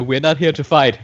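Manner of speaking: calling out. Acted. Player calls to foes before shooting, loud. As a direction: call it across to the enemy.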